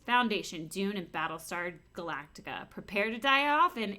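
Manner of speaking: reading aloud. Acted. Reads the store description.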